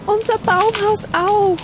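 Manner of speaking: high-pitched, alarmed squirrel voice; calling squirrels. A narrator's reading. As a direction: call out high like little squirrels. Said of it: "riefen die Eichhörnchen". (hohe, rufende Eichhörnchenstimme)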